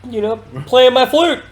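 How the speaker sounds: high, loud retold excuse; mock innocent denial. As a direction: imitate a suspect pleading innocence. Acted suspect denies accusation.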